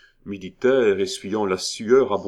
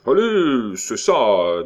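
loud, bitter retort; bitterly; 4 semitones higher, 7 dB louder, slower than usual. A narrator's reading. Militaire answers the call to speak, bitterly. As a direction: toss it back with sour resentment. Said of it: "dit amèrement ce dernier".

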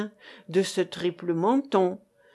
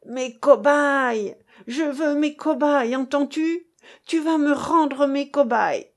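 high, loud demanding yell; 5 semitones higher, 5 dB louder than usual. A narrator's reading. Angry woman yells at the narrator for her guinea pigs. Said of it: "Elle hurlait".

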